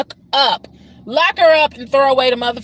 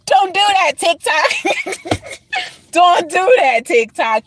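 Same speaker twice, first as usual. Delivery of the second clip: a laughing, raised telling-off; laughing scold; 6 semitones higher, slower than usual. Real, not acted. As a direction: scold the app through real laughter. Scolding TikTok while laughing.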